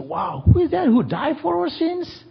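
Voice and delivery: high wondering crowd voice; amazed villagers. Retold or imitated. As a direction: gasp it out in wonder. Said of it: "and they said".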